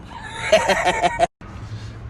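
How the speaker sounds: high-pitched laughing fit; real laugh. Real, not acted. Comic laughs at his cat joke, high laugh. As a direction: crack up right after the yell.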